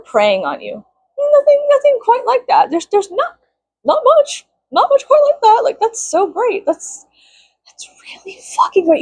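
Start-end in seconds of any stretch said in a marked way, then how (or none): 1.3-7.1 very high sing-song sarcasm; sarcastic praise. Acted. Mocking hometown predators, high sarcastic sing-song. 7.7-9.0 whispered mock praise; sarcastic whisper. Mocking the boys from school, sarcastic whisper.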